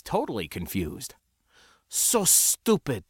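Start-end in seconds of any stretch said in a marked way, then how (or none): 1.9-3.0 put-on slow, higher-pitched accented contempt; growled. A narrator's reading. Sindra scolding the naive spacer, growling. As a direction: drag out the insult in scorn. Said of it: "Sindra growled".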